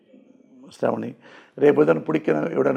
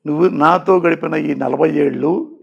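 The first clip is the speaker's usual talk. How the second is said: ordinary talk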